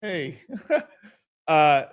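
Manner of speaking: ordinary delivery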